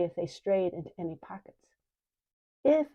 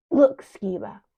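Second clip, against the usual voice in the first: hissed; 5 semitones higher, 10 dB louder than usual. Acted. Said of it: "hissed Orange Eyes".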